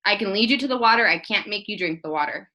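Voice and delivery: normal talking voice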